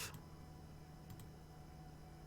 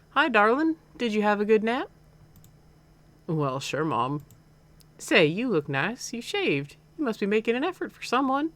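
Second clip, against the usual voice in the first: Southern mom voice, higher and lively; slightly southern accent; 7 semitones higher, 3 dB louder than usual. Acted. Mom greeting her son after his nap, slightly southern accent. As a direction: greet your son in a light Southern lilt. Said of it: "like, a slightly southern accent".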